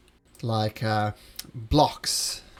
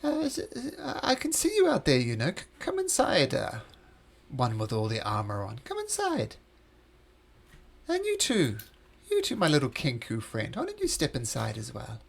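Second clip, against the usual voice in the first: beckoning unseen host. Acted. Game master voices the unseen host.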